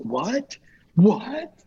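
rising What? What? in mock shock; mock surprise. [name] playing innocent to the judges, mock surprised. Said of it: "He's acting surprised".

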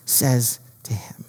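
slow, dramatic whisper; hushed whisper. A narrator's reading. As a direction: drop to a whisper, drawing it out. Sudden hush before God's answer.

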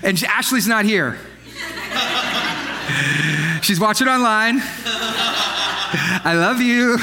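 real laughter between the words; laughing aside to his wife watching online. Real, not acted.

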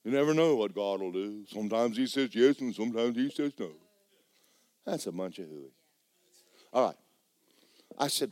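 ordinary talk

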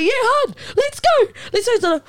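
very high mock yell; retold yelling back. Joking back at the girl outside the club, mock yell. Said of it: "like, yelling back".

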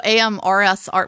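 normal talking voice

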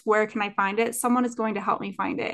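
usual speaking voice

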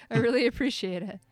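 laughing warmly while thanking; a happy laugh into the thanks; kind gentle laugh. Real, not acted. Host laughs thanking guest.

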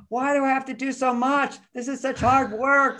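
high, whining complaint; whiny complaining woman. Retold or imitated. Woman listening to his advice, whining high and complaining. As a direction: whine it like a put-upon woman. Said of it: "some women are listening".

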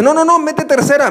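high, urgent shouted advice; panicked friend. Retold or imitated. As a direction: hurry the driver into third. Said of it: "uno te dice".

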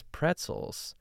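softly, no inflection at all, a dull echo of "Pretzels"; quiet unimpressed echo; flat deadpan repeat. Retold or imitated. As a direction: say "Pretzels" like it's the dumbest idea. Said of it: "she repeats the word pretzels with, like, no inflection".

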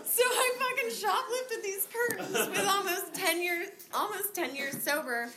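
high, loud words through laughter; laughing. Real, not acted. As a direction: laugh it out in disbelief at yourself. Laughing at own shoplifting.

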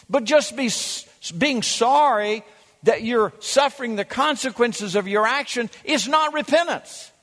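ordinary talk

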